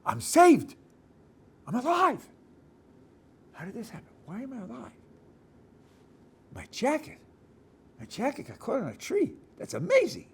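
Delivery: high, breathless wonder at being alive; a rescued rider marvelling at his luck; amazed survivor. Acted. Rescued rider voiced for the congregation, high and amazed. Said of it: "One way to look at it is like this".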